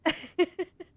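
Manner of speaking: high giggle while near crying; teary laugh. Real, not acted. Streamer laughing through happy tears.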